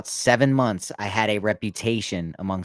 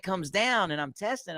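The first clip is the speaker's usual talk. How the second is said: usual speaking voice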